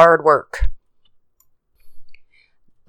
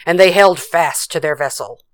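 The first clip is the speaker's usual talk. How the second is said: ordinary delivery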